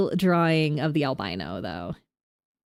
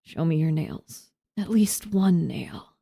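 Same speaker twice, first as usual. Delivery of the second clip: slow creepy whisper; eerie stranger behind the door; freaky whisper; slower than usual. Acted. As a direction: drag out the demand in a hush. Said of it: "I like doing freaky voices".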